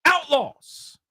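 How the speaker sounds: big ring announcer call; ringmaster. Retold or imitated. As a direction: announce it to an arena crowd. DX intro climax.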